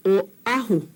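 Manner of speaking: "Oahu" broken after the O; marking the okina. Shown as a demonstration. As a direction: say "Oahu" as oh, catch, ah-hoo. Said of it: "Our Okina is here".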